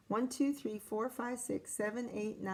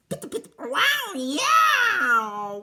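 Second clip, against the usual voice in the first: high, loud yowl; yowling cats; 9 semitones higher, 10 dB louder, much slower than usual. A narrator's reading. Reader yowling the cat fight's climax. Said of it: "funny cat sounds".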